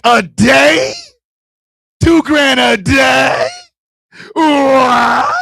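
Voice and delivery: loud, high incredulous questions; shocked disbelief. Retold or imitated. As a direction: yell it back in astonishment, building to a cry. Shocked at her earnings.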